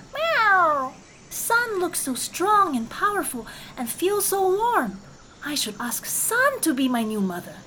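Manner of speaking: high kitten voice, eager; a little cat thinking big, pitched high; kitten's voice. Acted.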